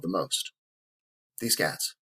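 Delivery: a near-tearful whisper, voice barely there; choked up. Real, not acted. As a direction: hold back the tears on the words. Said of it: "promise, I'm gonna get through this without crying".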